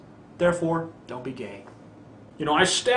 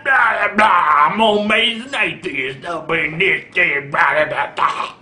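loud, slow, hammed-up hillbilly drawl with shouted blahs; mock a Southern preacher's hellfire, shouting; mock hillbilly accent; 4 semitones higher, 4 dB louder, slower than usual. Mocking the Jesus Freak's version of him in a loud hillbilly accent. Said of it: "'cause I have that hillbilly accent".

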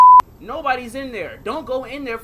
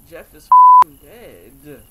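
slow, much louder drawn-out yell; anguished yell; 7 semitones lower, 22 dB louder, much slower than usual. Real, not acted. Gamer yelling that the friend is dead, slow and loud. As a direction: bellow it out in disbelief. Reacts to Jeff's death.

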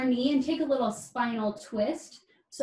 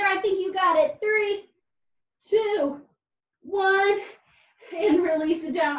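upbeat coach; 6 semitones higher, 5 dB louder than usual. Real, not acted. Coach counting down hype.

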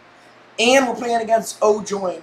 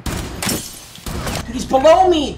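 alarmed shout; 8 semitones higher, much slower than usual. Real, not acted. Enemy spotted below him.

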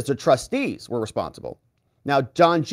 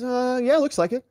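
higher, hesitant put-on witness voice; imitating Don Junior; 10 semitones higher than usual. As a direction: shrug it off as the unsure witness. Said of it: "He says".

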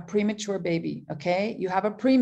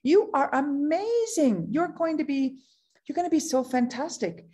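higher pitched thrilled praise; excited; 6 semitones higher than usual. Retold or imitated. Calling a young lady to celebrate, excited and higher. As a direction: gush over her quick success. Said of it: "I called her. I'm like".

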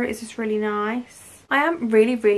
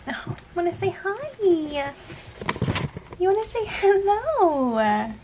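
dog voice; 8 semitones higher, slower than usual. Real, not acted. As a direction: coax the dog to greet the camera. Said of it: "Excuse my dog voice as well".

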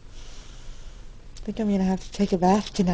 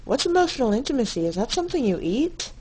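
fast, higher, playing dumb; mock innocent; 5 semitones higher, 4 dB louder, faster than usual. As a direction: feign total cluelessness. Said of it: "I said".

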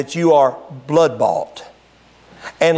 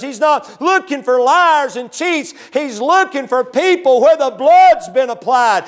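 full-force preaching; 8 semitones higher than usual. Acted. Pastor rousing the church about the blood, preaching at full force. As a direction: preach it hard to the congregation.

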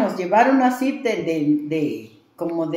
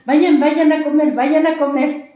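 much higher and louder, shooing them off to eat; loud urging; 8 semitones higher, 9 dB louder than usual. Retold or imitated. The master loudly sending the healers off to eat. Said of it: "Siempre nos decía así".

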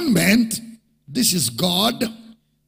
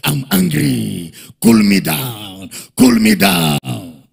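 low, building louder and slower on cool me down; raging God; 7 semitones lower, 4 dB louder, slower than usual. Pretend. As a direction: demand it, growing louder. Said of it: "God begins to rage again".

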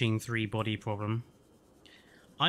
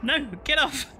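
high, loud panicked shouting; panicked yelling; 4 dB louder than usual. Real, not acted. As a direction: scream in real fright. Jump scare by snake crab.

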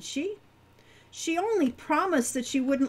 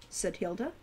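ordinary delivery, nothing marked

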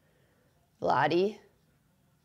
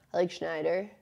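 ordinary talk

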